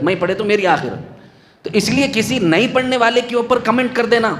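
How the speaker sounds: ordinary delivery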